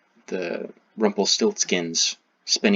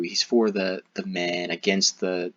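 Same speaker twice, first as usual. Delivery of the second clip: normal talking voice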